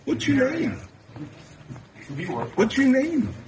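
high, heated taunt across a room; yelling across the room. Real, not acted. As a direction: call out across the room at him. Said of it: "[name] is yelling across".

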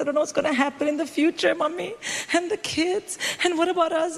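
put-on fast, high, teary "Mummy, and the kids"; hammed-up panicked sobbing plea; mock crying. Comic retelling crying to her mother on the phone, mock tearful. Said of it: "I was crying".

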